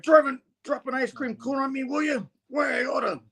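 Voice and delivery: high, far-off voice rising to a shout; mock-angry comic hero, hammed up; cartoon threat. Thing angry in comic retelling.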